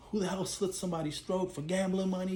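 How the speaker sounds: a hint of Jackie losing it; retold outburst. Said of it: "Jackie goes bananas".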